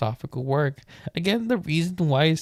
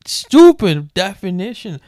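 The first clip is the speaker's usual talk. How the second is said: loud laughing scoff; laughing scorn; 5 semitones higher, 12 dB louder than usual. Real, not acted. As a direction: laugh it off, mocking. Mocking a bad definition.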